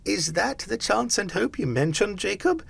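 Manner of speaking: high, uneasy question; faltering. A narrator's reading. Scrooge questioning the promised hope, faltering. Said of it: "he demanded in a faltering voice".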